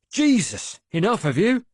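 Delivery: loud, high exclamation; an old man shouting in dismay; exasperated shout. A narrator's reading. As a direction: shout it in disbelief at the crowd.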